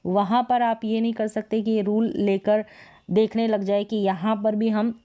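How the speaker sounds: ordinary talk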